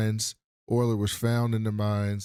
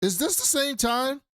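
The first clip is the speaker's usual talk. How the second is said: much higher, mock disbelief; stunned question; 13 semitones higher than usual. Cop staring at the footage, hammed-up high disbelief. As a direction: ask it in pitched-up disbelief.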